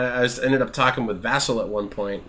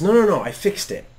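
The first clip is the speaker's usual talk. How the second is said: retold kid cutting in, higher pitched; insistent kid; 9 semitones higher, 4 dB louder than usual. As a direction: imitate an eager child's protest. Child insisting in retold talk.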